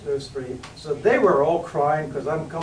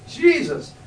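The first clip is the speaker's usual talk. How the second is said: Jesus cried out high and loud from the heart; heart's cry; 10 semitones higher, 4 dB louder than usual. Retold or imitated. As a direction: call out the name high and loud, straight from the heart. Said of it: "I just cried from the, my, the center of my heart".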